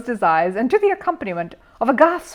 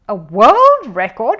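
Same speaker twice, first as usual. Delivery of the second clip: amazed question; 3 semitones higher, 11 dB louder, slower than usual. Acted. Curry amazed at record.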